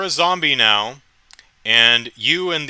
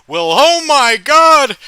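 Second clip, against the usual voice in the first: much higher, loud slow panicked shout; shocked yell; 13 semitones higher, 10 dB louder, slower than usual. Acted. Cop startled by the zombie girl, shouting high in horror.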